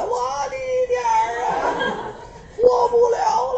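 high mock wailing, a half-crying shout; hammed-up wail. Princess wailing to her husband on the bed, mock crying wail. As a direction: wail like the world is ending, hammed up.

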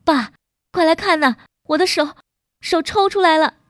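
high, excited calling out; crying-and-laughing shout. A narrator's reading. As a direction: call out to dad, overjoyed and overwrought. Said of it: "又哭又笑地喊起来".

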